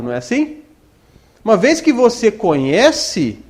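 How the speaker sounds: ordinary delivery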